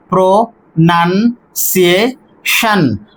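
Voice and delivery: pronunciation said very slowly, pro-nun-ci-ation; pronunciation broken into syllables slowly. Shown as a demonstration. Teacher slowing down pronunciation to count syllables. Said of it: "Here we have four syllables".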